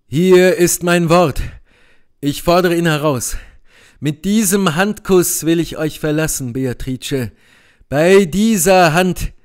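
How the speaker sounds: resolute Benedikt. Acted.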